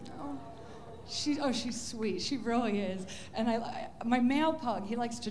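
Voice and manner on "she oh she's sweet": ordinary talk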